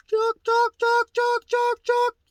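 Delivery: very high, loud, rhythmic "chuck" calls; mimicked turkey yelp. Shown as a demonstration. Teacher showing jaw movement in a yelp, loud high turkey mimicry. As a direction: squawk "chuck" in a falsetto turkey cadence. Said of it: "just the same as a turkey".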